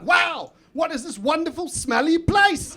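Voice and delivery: high-pitched awe, slowing at the end; retold wonder. First visit to a charity store, mock awestruck. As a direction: marvel in exaggerated delight.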